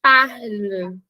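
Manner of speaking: "parle" said slowly, with an E sound and not an O; slow "parle", mouth rounded but the vowel an E; French pronunciation demo. Shown as a demonstration. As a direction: demonstrate the E in "parle". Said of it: "mas você faz o som de E".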